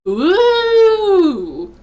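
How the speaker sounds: high, loud, excited ooh; delighted ooh. Real, not acted. Player cheering Athena's comeback with a high, loud ooh. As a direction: squeal it out in glee.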